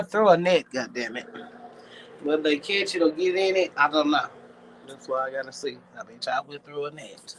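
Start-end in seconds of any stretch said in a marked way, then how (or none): none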